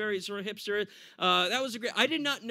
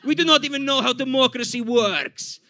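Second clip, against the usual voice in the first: put-on louder, indignant Russian accent; belligerent drunk Russia; 3 semitones higher, 6 dB louder than usual. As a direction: protest loudly in a mock Russian accent. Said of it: "Russia gets a little too belligerent".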